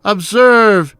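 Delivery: loud, frantic theatrical confession; crying out. Acted. Murderer admitting the deed to the cops, crying out. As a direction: declaim it loudly, as if unable to hold it in. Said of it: "I cried".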